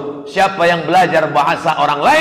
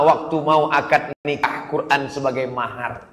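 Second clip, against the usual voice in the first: normal talking voice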